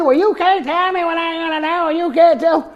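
a lightly voiced defiant friend; imitating the friend. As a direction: mimic her talking back. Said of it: "She began to tell me".

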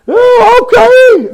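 high, bright reluctant okay; a high-voiced friend saying okay; mock meek agreement. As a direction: mimic her giving in. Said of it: "she agrees".

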